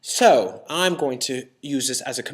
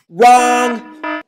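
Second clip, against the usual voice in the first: high, loud drawn-out buzzer-style shout; emphatic rejection; 7 semitones higher, 10 dB louder than usual. Acted. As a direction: shout down the wrong idea. Loudly rejecting the imagined easy view.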